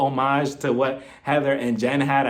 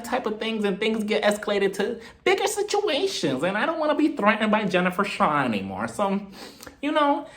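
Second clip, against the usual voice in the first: Meredith impression; 8 semitones higher than usual. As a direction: imitate her preachy calm in a high voice. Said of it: "Meredith is just like".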